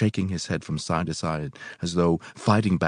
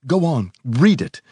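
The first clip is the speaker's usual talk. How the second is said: raised, urgent command; agitated, higher-pitched order; excited cry; 7 semitones higher, 4 dB louder than usual. A narrator's reading. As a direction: urge them on excitedly, voice lifted. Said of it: "he cried excitedly".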